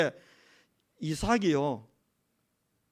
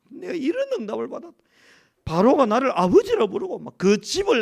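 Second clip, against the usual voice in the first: high, excited retelling as Joseph; 요셉의 벅찬 말 흉내; 4 semitones higher, 4 dB louder than usual. Retold or imitated. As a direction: act out overflowing confidence, pitch climbing high. Acts out Joseph reassuring his brothers. (높은 소리로 벅차게 외치는 요셉 연기)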